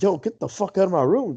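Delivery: mildly outraged host kicking people out; indignant. Retold or imitated. Retells ordering guests out.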